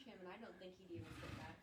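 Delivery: fast, whispered aside. Real, not acted. Friend jokes quietly about the new hire, fast whisper. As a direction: murmur it quickly on the side. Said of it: "whispered aside".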